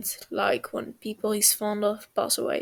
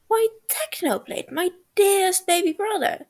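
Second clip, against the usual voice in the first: mock sweet; 8 semitones higher, 3 dB louder than usual. Wilbur mock-sweet teasing.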